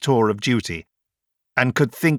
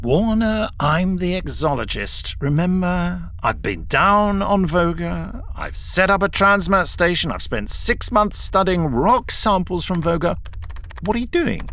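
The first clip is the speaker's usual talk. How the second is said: higher, exasperated professor voice; impatient; 7 semitones higher than usual. A narrator's reading. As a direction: press him with impatience. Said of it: "Kelman gave an impatient sigh".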